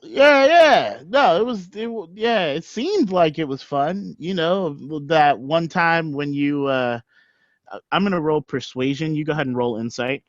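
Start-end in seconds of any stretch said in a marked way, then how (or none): 0.0-7.0 high, nervous backpedal; flustered high voice. Acted. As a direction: cover the lie, pitched up. Caught lying, flustered.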